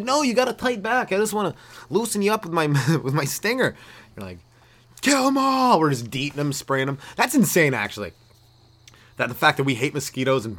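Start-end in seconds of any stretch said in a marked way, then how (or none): 0.0-3.8 high eager voice, fast; a pushy helpful mosquito; mock mosquito. 5.0-5.8 high, loud war cry; rally the troops against bugs; mock battle cry. People react to mosquitoes.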